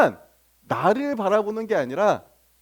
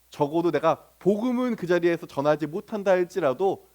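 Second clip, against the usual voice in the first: normal talking voice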